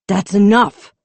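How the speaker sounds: loud stern stop; firm command. A narrator's reading. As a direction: shut it down with authority. Chief intervenes firmly.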